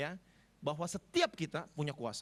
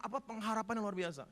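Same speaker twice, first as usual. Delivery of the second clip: usual speaking voice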